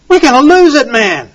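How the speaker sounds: high, loud alarm; urgent warning. Real, not acted. Preacher warning listeners they'll lose liberty, loud and high. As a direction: sound the alarm to the crowd.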